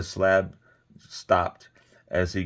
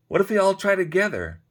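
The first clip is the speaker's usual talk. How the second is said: small voice from crowd; 12 semitones higher, 4 dB louder, faster than usual. A narrator's reading. Someone in crowd suggests.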